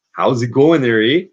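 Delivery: hammed-up Canadian accent. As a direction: greet them in a playful, put-on Canadian accent. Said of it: "if I can really keep this accent up".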